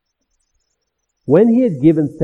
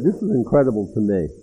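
usual speaking voice

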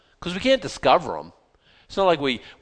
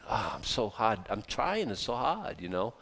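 softer, sighing complaint of a weak believer; mock whining; 3 semitones lower, 9 dB quieter than usual. Preacher mimics a believer's excuse, in a soft mock whine.

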